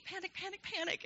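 high, squeaky mock alarm; mock panic. Texting Audrey in a crisis, high mock panic. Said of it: "it's me".

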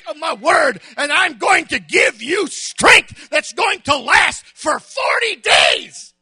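loud, high preaching voice; the preacher voicing God's command at full force; fiery preaching. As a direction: drive it home to the church.

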